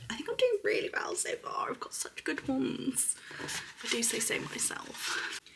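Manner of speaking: high, slow unvoiced whisper; whispered aside. Vlogger praising her own picks, high playful whisper. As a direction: whisper it, pleased with yourself.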